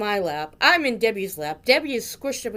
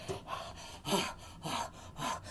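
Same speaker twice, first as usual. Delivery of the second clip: soft, breath-only gasps; panting with relief; 3 semitones lower, 12 dB quieter, much slower than usual. Retold or imitated. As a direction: puff out relieved, winded breaths. Retold relief, panting after cranking.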